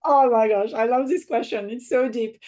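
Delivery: light up at the question; delighted exclamation. Real, not acted. Delighted reaction to host's question.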